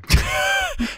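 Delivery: high howling wolf cry. Pretend. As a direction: howl like a wolf. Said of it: "Why did you do a wolf howl?".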